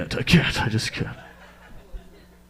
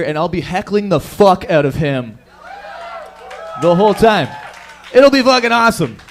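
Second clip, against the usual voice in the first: louder, higher, amped-up boast; loud hype; 4 semitones higher, 9 dB louder than usual. Real, not acted. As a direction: crank it up like a hyped host. Hyping heckling plan.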